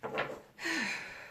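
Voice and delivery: long breathy exhale; heavy sigh. Real, not acted. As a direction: sigh it out to shake off the feeling. Shaking off the emotional reading.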